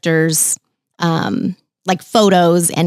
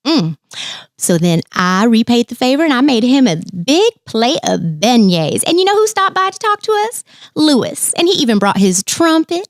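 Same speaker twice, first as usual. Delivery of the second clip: put-on animated sweet Southern accent, lilting high; Princess Tiana; 4 semitones higher than usual. Princess telling a little park guest about her morning, sweet Southern accent. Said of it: "Can you do the accent for us?".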